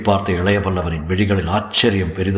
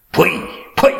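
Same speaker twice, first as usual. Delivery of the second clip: high, sharp outburst; raised-pitch cry of outraged denial; indignant denial; 9 semitones higher than usual. Acted. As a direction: burst out in protest. Hero rejects the accusation.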